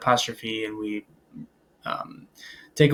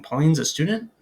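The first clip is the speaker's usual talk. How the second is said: "Pauline's a student" louder, climbing high on "student"; rising question intonation; 4 semitones higher, 5 dB louder than usual. Shown as a demonstration. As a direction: ask "Pauline's a student" with a clear lift at the end. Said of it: "But here I'm asking".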